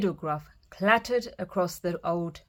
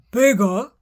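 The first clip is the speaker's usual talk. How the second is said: loud eager troll questions; louder troll perking up at food; greedy cry; 3 semitones higher, 8 dB louder than usual. Acted. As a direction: cry out hungrily. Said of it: "he cried".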